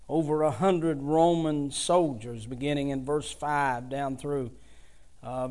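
ordinary delivery

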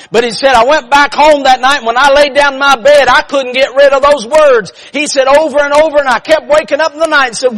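high, loud, driving testimony; full-force preaching. Real, not acted. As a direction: push hard to a shouted salvation climax.

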